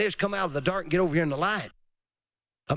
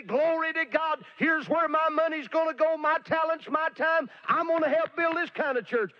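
high, gushing mock convert voice; mocking eager convert; 10 semitones higher than usual. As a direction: ham up an eager new church member. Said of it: "jump on the bandwagon and say".

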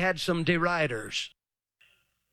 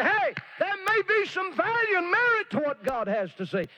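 high, breaking plea; pleading cry; 13 semitones higher than usual. Real, not acted. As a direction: beg them with a cracking voice. Pleading with mockers, voice cracking.

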